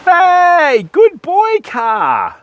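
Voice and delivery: high pet-talk voice; playful praise. Acted. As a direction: praise him like a good dog.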